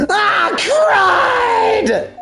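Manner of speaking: frustrated outburst. Acted. Caught out, character exclaims.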